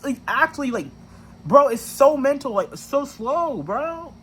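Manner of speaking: high, incredulous outburst; real frustrated venting, mostly high; exasperated rant. Real, not acted. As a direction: pitch it up in disbelief at how dumb it is. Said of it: "it makes me angry".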